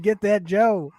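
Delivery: ordinary talk, nothing marked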